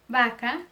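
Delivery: vaca with a B sound, "baca". Shown as a demonstration. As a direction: start vaca on a B, lips together. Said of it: "ele vai ser pronunciado como B". (V com som de B em vaca)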